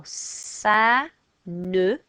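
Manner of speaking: "ça ne" said slowly, nuh; slow the "ne" right down; slow French ça ne. Shown as a demonstration. Teacher showing negation word by word, slow. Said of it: "So we go".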